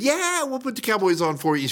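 a hint of a sweet, high girl's voice. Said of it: "this sweet little girl at the".

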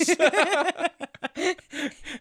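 squealing laugh, pitch way up; high burst of laughter. Real, not acted. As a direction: break into a high helpless laugh. Cracking up at the quote.

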